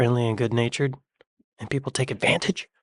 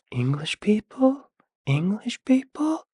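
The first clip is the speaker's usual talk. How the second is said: rising ever higher, slowly drawn out; mock disbelief; 12 semitones higher, slower than usual. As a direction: climb to a squeaky high pitch of disbelief. Mock disbelief, climbing higher each time.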